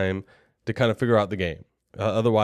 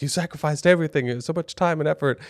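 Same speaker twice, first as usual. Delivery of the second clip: imitating crying wife; 6 semitones higher than usual. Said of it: "she legitimately cries".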